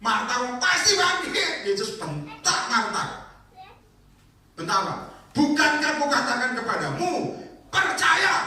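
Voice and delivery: high, loud preaching at full force; fiery preaching. Acted. Preacher retelling Martha and Jesus to the church, loud and high. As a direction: preach it hard to the crowd.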